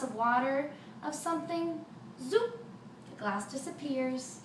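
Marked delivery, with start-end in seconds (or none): 2.2-2.6 a sharp rising zoop, much higher; zing it up high like a magic wand; high zoop sound effect. A narrator's reading. Storyteller makes the wand vanish a glass, rising zoop.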